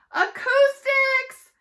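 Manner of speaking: a crowd screaming at the screen. Acted. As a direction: scream the answer at the TV. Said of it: "Everybody screamed".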